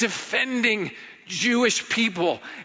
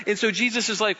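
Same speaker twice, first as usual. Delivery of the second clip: ordinary delivery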